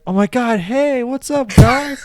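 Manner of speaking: high, loud, gushing hello to newcomers; squealy mock welcome; bubbly party greeting. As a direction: greet the room like an over-eager host. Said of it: "I was just like".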